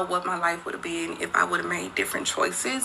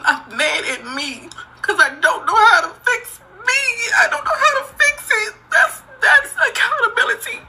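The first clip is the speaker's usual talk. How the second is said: high, loud sobbing cry; 14 semitones higher, 3 dB louder than usual. Real, not acted. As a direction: break down and cry it out. Crying confession, voice cracking high.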